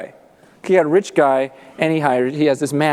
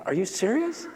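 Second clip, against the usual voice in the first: high, softer incredulous question; quietly astonished, pitch raised; hushed disbelief; 6 semitones higher, 7 dB quieter than usual. Acted. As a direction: act out a debtor who can't believe it. Second debtor reacts to the offer.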